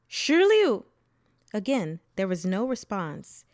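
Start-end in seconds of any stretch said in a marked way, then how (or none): 0.1-0.9 high, loud call over a crowd; shout. A narrator's reading. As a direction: shout the name out, searching. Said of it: "looked over the crowd and shouted".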